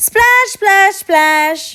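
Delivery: loud, high splashing noises; splash sounds. Acted. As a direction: splash hard each time. Splashing puddles.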